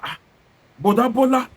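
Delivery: ordinary talk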